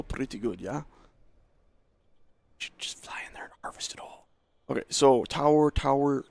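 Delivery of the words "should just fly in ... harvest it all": whispered aside. Real, not acted. Plotting aloud to himself, he whispers the plan.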